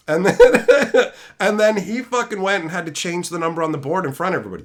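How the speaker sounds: usual speaking voice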